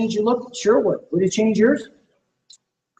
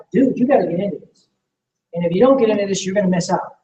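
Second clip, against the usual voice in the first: ordinary delivery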